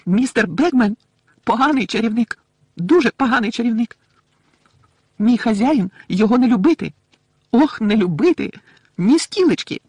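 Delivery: high, loud indignant squeak; angry little elf denouncing him; emphatic squeaky disapproval; furious elf. A narrator's reading. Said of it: "знову набрала розлюченого вигляду".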